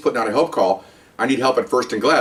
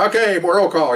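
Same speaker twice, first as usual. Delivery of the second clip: higher-pitched sergeant's call-out; sergeant calling roll; 6 semitones higher than usual. Retold or imitated. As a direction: call the room to order, pitched up.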